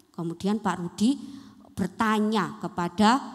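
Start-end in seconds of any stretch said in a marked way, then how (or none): none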